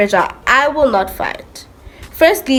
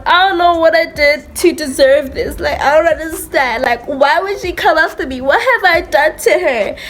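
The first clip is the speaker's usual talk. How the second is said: higher, pleading crying voice; retold crying; 7 semitones higher than usual. To friend after the fight, tearfully pleading. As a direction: replay the tearful confusion after being hit. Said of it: "You know, I'm out there crying".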